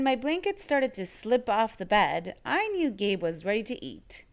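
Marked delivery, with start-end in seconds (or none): none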